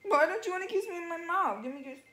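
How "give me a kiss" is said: usual speaking voice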